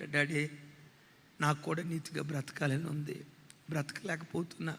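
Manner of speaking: a hint of tears, dropping away quietly; mild retold crying. Son crying to his father at midnight, soft tearful plea. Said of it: "ఏడుస్తూ అంటాడట".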